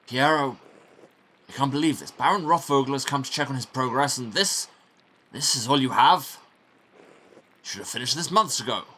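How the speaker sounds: berating monk. Acted. Monk berating old Piero over unfinished work, scornful. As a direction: pile the blame on the old man.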